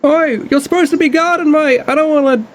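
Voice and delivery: high brash cockney captain voice; cockney pirate captain. Acted. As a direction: bark it like a cocky pirate boss.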